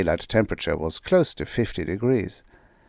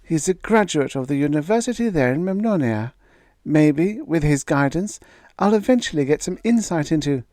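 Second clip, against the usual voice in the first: put-on higher, earnest young daughter; a daughter defending her fiancé, pitched higher; young woman's voice; 8 semitones higher than usual. A narrator's reading. Daughter defending Gregrill to her father, higher young voice.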